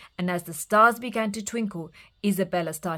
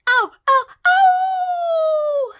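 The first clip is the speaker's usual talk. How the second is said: loud, much higher yelps of pain; wolf howling with a hurt nose; 11 dB louder, much slower than usual. Acted. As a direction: howl in pain. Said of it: "howled the wolf".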